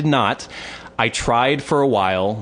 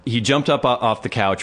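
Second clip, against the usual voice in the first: normal talking voice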